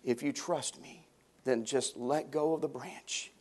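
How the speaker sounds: soft, calm heavenly voice. Retold or imitated. God answers the dangling man, soft and calm. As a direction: speak gently from above. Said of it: "he heard the voice as it said".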